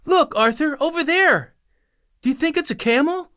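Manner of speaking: put-on high, excited child's call; a young boy shouting excitedly to his brother. A narrator's reading. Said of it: "shouted to his older brother".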